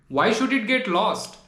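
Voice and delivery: ordinary talk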